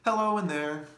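loud, high call into a hollow space; owl's hello, called out loud. Acted. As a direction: call in to see if anyone's home. Owl calls into the brain.

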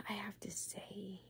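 slow musing whisper. Acted. ASMR host muses to the viewer in a slow whisper. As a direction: whisper it to yourself.

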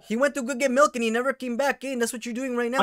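hammed-up Chicano slang voice. Prank caller in a Chicano accent berating the scammer. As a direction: play a touchy Chicano vato with a Chicano accent.

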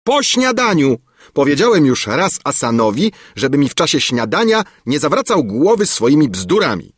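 high, loud, exasperated rebuke; displeased royal retort. A narrator's reading. King rebuking the minister for interrupting breakfast, exasperated. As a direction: snub the minister with rising irritation. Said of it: "odparł monarcha i w dowód najwyższej niełaski".

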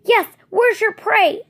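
high loud slow demand. Acted. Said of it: "another demanded".

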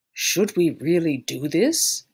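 slow, doubtful man's voice; hesitant Mister Cox. A narrator's reading. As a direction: drag out the worried question. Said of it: "asked Mister Cox".

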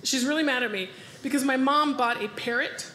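ordinary talk, nothing marked

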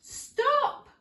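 high, loud shout; old man shouting after the gingerbread man; cry of stop. Acted. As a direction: yell after the runaway. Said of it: "cried the boy".

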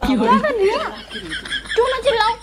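indignant. Acted. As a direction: confront them in outraged disbelief. Confronts brother, refuses to stay quiet.

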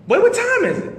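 high-pitched mom voice, disbelieving; incredulous mother. Retold or imitated. Mother hears son leaving at dawn, high incredulous voice. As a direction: play the mom, high and baffled.

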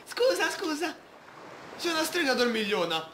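high cartoon girl apologizing; girl character voice. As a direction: squeak out a sheepish sorry as the banana-tufted girl. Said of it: "Ciuffetta banana parlerà così".

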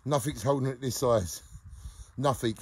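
normal talking voice

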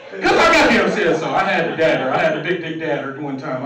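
ordinary talk

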